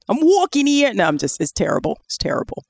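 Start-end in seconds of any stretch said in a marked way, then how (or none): 0.1-0.9 higher, hammed-up New York accent; bad New York accent. Showing off bad New York accents, badly faked. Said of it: "bad New York accents".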